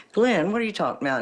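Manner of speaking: high, rapid incredulous question; retold alarmed disbelief. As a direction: blurt it fast, pitch jumping on the name.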